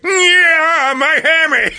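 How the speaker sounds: hammed-up hamstring scream; mock scream. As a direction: yell it like the newsman. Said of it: "I did the exact same thing".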